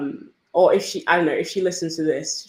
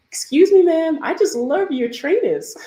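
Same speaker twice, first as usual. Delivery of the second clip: higher, louder imitation of a friendly stranger; mock-effusive older lady, pitched way up; older lady calling out; 10 semitones higher, 5 dB louder than usual. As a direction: call it out in an exaggerated gushing imitation. Acting older lady's call.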